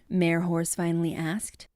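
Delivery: plain reading voice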